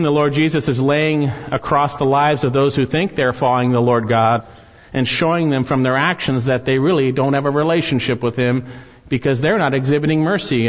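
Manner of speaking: ordinary talk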